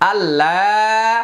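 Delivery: আল্লাহ drawn out slowly on a long আ; long drawn vowel. Shown as a demonstration. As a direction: hold the আ of আল্লাহ for three counts. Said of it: "এখানে তিন আলিফ টানতে হবে". (আল্লাহ দীর্ঘ টেনে)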